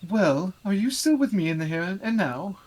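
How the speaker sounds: high, loud, slow pompous lord. Acted. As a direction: play a haughty noble, grandly. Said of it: "the pompous voice".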